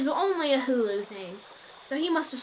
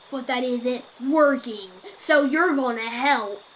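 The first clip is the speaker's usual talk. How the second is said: lightly voiced demanding creep, slowing at the end; a hint of a pushy stranger; mildly menacing retold caller; scratchy creepy guy; 3 dB louder than usual. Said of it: "His voice turned to a scratchy, creepy guy".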